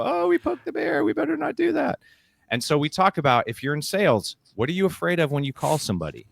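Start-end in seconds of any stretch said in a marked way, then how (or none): 0.0-2.0 much higher, timid put-on voice; mocking our own fear after poking the bear, in a tiny scared voice; mock scared squeak. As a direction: squeak it like a frightened little creature. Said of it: "we get scared and go".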